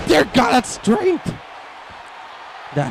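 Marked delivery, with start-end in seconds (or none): 0.1-1.3 high, slow stunned cry of awe; awed shout. Real, not acted. Commentator awed by the wrestler's strength, shouting. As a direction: gasp it out at the power.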